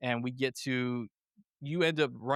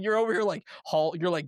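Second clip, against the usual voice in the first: usual speaking voice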